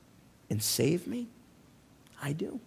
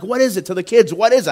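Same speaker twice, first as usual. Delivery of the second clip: retold scream; 5 semitones higher, 12 dB louder, faster than usual. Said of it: "I'm screaming".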